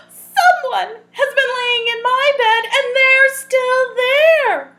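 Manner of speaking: tiny cub squeaking in alarm; squealing Baby Bear. Acted. Reading to children, baby bear finds Goldilocks, high squeal.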